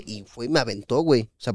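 normal talking voice

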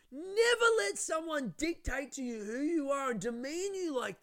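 high, drawn-out impassioned shouting; yelling. Retold or imitated. Friend berates comedian in the cab, imitated full-voiced high yelling. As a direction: imitate an outraged friend screaming a scolding. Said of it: "Blake starts yelling at me".

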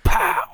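high, loud mock pow; slap sound. Recalling brother's stiff slap, a high loud pow. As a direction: snap out the impact noise.

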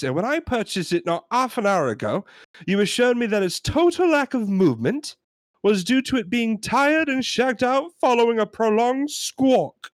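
high, fed-up British customer; hammed-up British complainer; British accent. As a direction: rant indignantly in a put-on British accent. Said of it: "We're gonna try our British accents".

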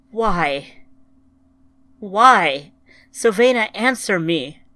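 loud, grief-stricken demand; anguished cry. A narrator's reading. Ryan demands an answer from the planet, crying out. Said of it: "she cried to the planet".

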